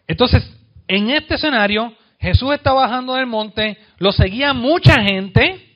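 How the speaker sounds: high, fired-up preacher voice; impassioned sermon build; preaching at full force. Acted. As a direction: paint the crowd scene with rising fervour.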